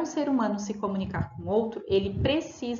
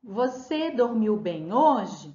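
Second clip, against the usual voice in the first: slow, louder question with a rising tune; question intonation; 4 dB louder, much slower than usual. Shown as a demonstration. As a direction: ask it clearly as a question, drawn out. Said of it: "se eu faço uma pergunta".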